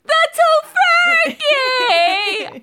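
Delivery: high, slow wailing shout; anguished shout. Retold or imitated. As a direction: cry out over the ruined dinner. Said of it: "And she's like".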